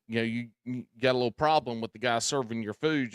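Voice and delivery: normal talking voice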